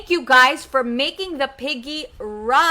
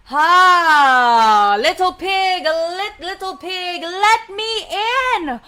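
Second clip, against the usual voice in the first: drawn-out wolf call to the pig; big bad wolf; 4 semitones higher, 4 dB louder, slower than usual. Wolf at the pig's door, calling in a put-on voice. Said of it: "the wolf said".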